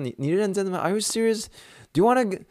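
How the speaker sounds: high, put-on voice of the joking therapist; mock the coworker teasing the boy; playful high mimicry; imitating the therapist. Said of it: "然后说".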